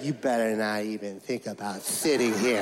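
put-on low, menacing kid bully. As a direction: warn him off the seat, low and mean. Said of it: "he's like".

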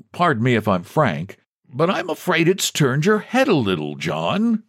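mildly patronizing bank president; lightly voiced patronizing president. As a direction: humour a deluded man, pitch swinging up. President patronizes the pleading man.

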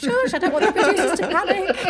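high, loud, laughing whisper-plea; laughing hush. Retold or imitated. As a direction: hush them while laughing. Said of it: "I was like".

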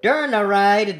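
ordinary talk

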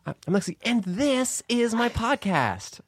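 high, very slow singsong podcast intro; Alexi Wasser impression. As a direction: stretch the show intro out like a mimicked host. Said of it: "I think it's something like that".

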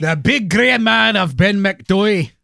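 hammed-up spooky title; spooky echo. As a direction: repeat the title like a horror trailer. Echoes title dramatically.

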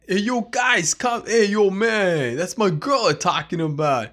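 lightly voiced greaser boss; tough greaser. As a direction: play the cocky leader. Acting Grease scene, Danny.

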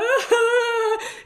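high wheezing laugh-sob; sobbing laugh. Real, not acted. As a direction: laugh until it turns to tears. Risa llorosa. (risa entrecortada como llanto)

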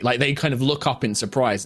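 ordinary delivery

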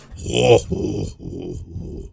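super deep monster "ho ho ho"; deep Bowser laugh. Shown as a demonstration. Gamer showing how hard Bowser is to voice, deep roaring laugh. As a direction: rumble out a low villain laugh. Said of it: "it's always that super deep".

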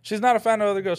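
ordinary talk

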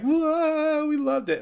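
high, drawn-out mock cheer; cheering crowd. Crowd reacting to a silly musical finish, mock cheering. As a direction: whoop it like a delighted audience. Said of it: "the crowd will be like".

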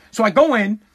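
usual speaking voice

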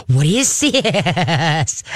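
very slow, stretched wondering; hushed fascinated question to the dog; amazed curious. Shown as a demonstration. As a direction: marvel at the object. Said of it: "you gotta laugh with your voice".

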